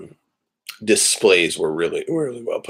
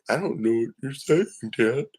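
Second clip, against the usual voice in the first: lightly voiced puzzled dog, softer; voicing the dog; 3 semitones higher, 5 dB quieter than usual. Dad voices his dog answering him. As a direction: answer Dad as the confused puppy.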